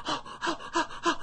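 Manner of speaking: hyperventilating. Retold or imitated. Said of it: "I'm hyperventilating and shit".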